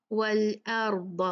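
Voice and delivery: slow "वल अर्द" with thick r and d; "वल अर्द" recited full-mouthed; heavy letters. Shown as a demonstration. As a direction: darken "र" and "द" in "वल अर्द". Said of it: "भारी पढ़ते हैं".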